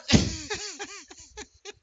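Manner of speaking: a high burst of laughter. Real, not acted. Amused by the politicians, high laugh.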